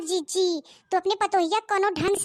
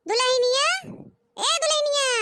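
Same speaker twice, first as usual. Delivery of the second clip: mother-in-law calling out; 6 semitones higher than usual. Acted. Mother-in-law calls the bride.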